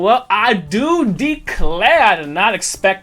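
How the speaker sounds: a hint of a Southern colonel accent; Southern gentleman accent. GM voicing Jedediah to the party in a Southern accent. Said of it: "That motherfucker's accent".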